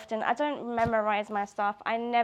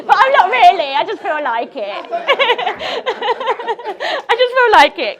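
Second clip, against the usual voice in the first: laughing through the words, much higher and louder; 10 semitones higher, 13 dB louder, faster than usual. Real, not acted. Admitting her joke to friends, laughing hard. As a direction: giggle it out.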